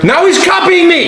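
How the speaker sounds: put-on high whiny kid tattling; whiny tattling little sibling. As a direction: whine like a bratty little brother. Sibling complains to dad.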